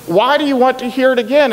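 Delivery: fed up with repeating himself; irritated. Acted. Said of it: "getting irritated by this now".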